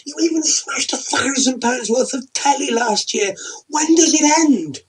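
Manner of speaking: high, loud, fed-up scolding; exasperated tirade; berating wife. Acted. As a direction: tear into him for acting like a teen.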